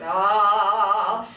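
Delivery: deep chesty ah; low register ah. Shown as a demonstration. Teacher showing women the low sound, chesty ah. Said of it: "Not very many women make that sound".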